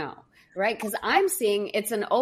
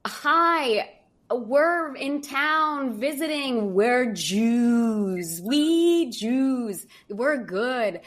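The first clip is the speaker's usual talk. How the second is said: eager pleading; 5 semitones higher, much slower than usual. Retold or imitated. Pleading to caretaker at door.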